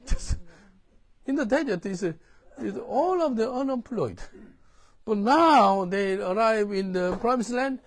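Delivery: loud, high, slow preaching; forceful preaching. Real, not acted. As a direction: drive the point home from the pulpit.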